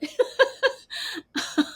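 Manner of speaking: airy high laugh; amused laugh. Real, not acted. Guest laughing as she recalls grandma's perm, airy laugh. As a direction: let a laugh burst out.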